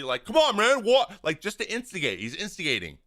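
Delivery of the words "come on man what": imitated loud, high-pitched challenge; super aggressive. Umpire running out to instigate, super aggressive. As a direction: pick a fight, voice jumping up. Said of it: "does this super aggressively".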